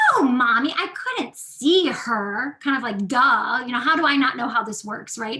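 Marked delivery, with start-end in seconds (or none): 0.1-2.6 put-on slow, louder know-it-all child; duh tone. Daughter explaining the obvious to mommy, slow duh tone. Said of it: "Is how she was talking to me". 3.1-5.2 duh tone. Retold or imitated. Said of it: "Is how she was talking to me".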